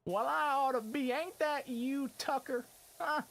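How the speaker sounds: high, loud cartoon Texan greeting; hammed-up Texas twang, calling out; mock Texan yelling hello; Texas accent. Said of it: "Texas accent".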